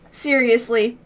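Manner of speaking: ordinary delivery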